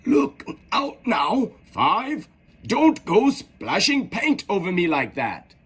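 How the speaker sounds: high, slow gardener. A narrator's reading. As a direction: fret like a clumsy gardener. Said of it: "she heard one of them say".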